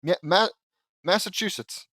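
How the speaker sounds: high, panicked stammering blurt; flustered, high-pitched fumbling; cracking under pressure. A narrator's reading. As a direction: blurt the wrong answer in a panic. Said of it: "Lincoln cracked under the pressure".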